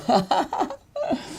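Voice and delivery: high-pitched laugh; amused high giggle. Real, not acted. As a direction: burst into a short high laugh. Laughs after mock comforting.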